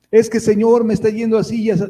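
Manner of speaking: a worried believer's plea; anxious complaint. Acted. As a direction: whine to God about your troubles.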